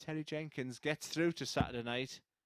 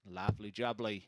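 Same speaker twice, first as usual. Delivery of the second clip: put-on southern accent; 5 semitones lower than usual. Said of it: "That's my southern accent there".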